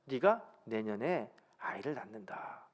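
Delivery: slow near-whisper, low; soft, solemn voicing of God; hushed promise. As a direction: breathe out the promise slowly, as God. God's promise voiced in retelling.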